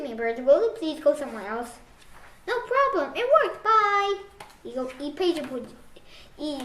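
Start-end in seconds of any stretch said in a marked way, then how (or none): 2.5-4.2 higher, slow sing-song farewell; cheerful goodbye. Acted. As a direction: chirp a happy bye. Bird cheerfully leaves.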